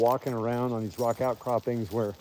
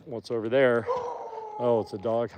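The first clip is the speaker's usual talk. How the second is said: ordinary talk, nothing marked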